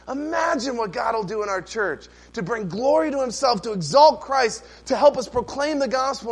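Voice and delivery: rising, loud and high, rallying the church; preaching at full force. Real, not acted. Pastor rallying his congregation to outreach, ringing and loud. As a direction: build to a ringing preacher's cry.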